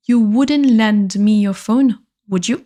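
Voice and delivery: slow, careful repeat of the request; langzaam herhaald. Shown as a demonstration. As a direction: spell it out slowly for the class. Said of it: "slow repeat for learners".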